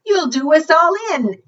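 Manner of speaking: put-on alarmed girl. Girl warning the moose he'll sink them, alarmed. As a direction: warn in a fret.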